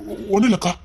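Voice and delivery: choked-up, halting denial; tearful. Acted. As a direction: stammer it through held-back tears. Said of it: "ஏன்டா அழுற சொல்லு". (தழுதழுத்த அழுகைக் குரல்)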